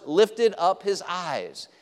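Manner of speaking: "lifted up his eyes" drawn out slowly, weight on "lifted"; slow each word of "lifted up his eyes"; slow emphatic quote. Shown as a demonstration. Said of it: "the Bible uses the words".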